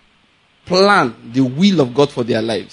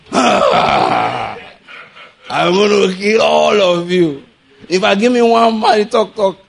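Goliath's taunt; 4 semitones higher, slower than usual. Retold or imitated. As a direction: roar it like a giant daring them to fight.

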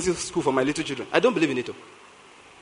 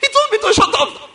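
mock outrage. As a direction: act the child's shock. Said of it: "she was so agitated".

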